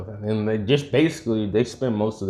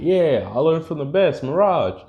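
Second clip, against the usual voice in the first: higher-pitched, lightly voiced cocky kid; mock-boast in a raised voice; retold boast; 6 semitones higher than usual. Kid answers Mirage's praise in the fight, retold boast.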